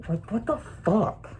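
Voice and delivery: high, incredulous what the fuck; heated outburst. Real, not acted. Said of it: "I get a little bit heated".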